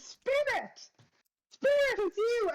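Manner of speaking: very high, joyful squeal of recognition; excited squeal. Acted. Fides spotting her old friend, squealing with joy. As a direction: shriek the name like a long-lost friend. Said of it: "and she goes".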